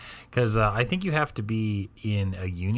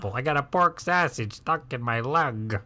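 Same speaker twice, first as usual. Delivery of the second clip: put-on Chicago Bears fan accent; slower than usual. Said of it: "the Bears saying".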